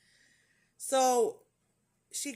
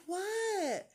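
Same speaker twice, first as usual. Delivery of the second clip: much higher, imitated puzzled nurse; mock clueless "what?"; 12 semitones higher than usual. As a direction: play the oblivious nurse, pitched way up. Acts out the oblivious nurse.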